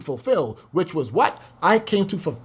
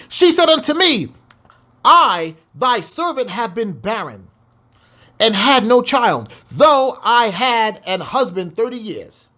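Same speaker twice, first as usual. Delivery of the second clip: scripture reading; 4 semitones higher than usual. Acted. Reads scripture aloud.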